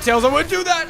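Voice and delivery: high, loud, urgent warning; frantic shout; panicked warning. Real, not acted. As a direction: plead in rising alarm.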